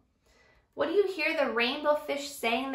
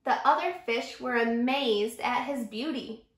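ordinary delivery

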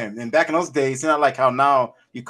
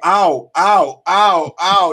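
mock ow; 2 semitones higher, 8 dB louder, much slower than usual. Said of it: "He, he was like".

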